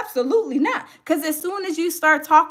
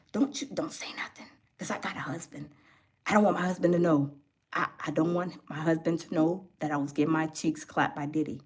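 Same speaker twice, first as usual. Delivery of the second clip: breathy whisper, much quieter and lower, like hiding a secret from her husband; 13 semitones lower, 11 dB quieter than usual. Retold or imitated. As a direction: whisper it so nobody hears. Sarah's secret confession acted out.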